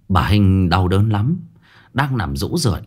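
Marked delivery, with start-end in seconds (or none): none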